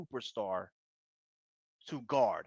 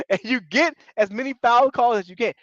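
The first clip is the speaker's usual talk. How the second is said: laughing; 9 semitones higher, 4 dB louder than usual. Real, not acted. Laughing after censoring himself.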